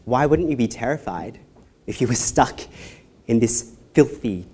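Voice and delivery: ordinary talk, nothing marked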